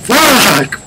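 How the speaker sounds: loud high curse; startled yell. Real, not acted. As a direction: shriek it in fright. Monster appears.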